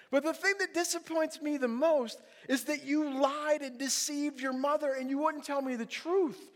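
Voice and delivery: stern scolding. Retold or imitated. Father reproaching son for deceiving mother, acted scolding. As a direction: scold with loud, pressing emphasis.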